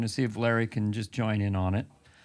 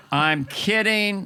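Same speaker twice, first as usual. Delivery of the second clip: ordinary talk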